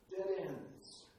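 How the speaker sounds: usual speaking voice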